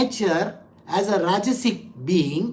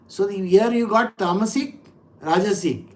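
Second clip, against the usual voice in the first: normal talking voice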